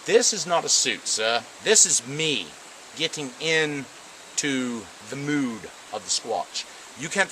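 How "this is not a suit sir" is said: ordinary talk, nothing marked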